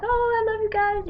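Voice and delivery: light mock-gush at family reunion; happily greeting family at the airport. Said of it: "I'm all like".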